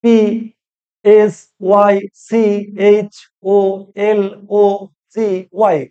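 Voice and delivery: letter by letter, slow and emphatic; spelled out. Shown as a demonstration. Teacher spelling psychology for learners, slow and deliberate. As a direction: spell পি এস Y one letter at a time, with pauses. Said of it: "পি এর উচ্চারণ হচ্ছে না".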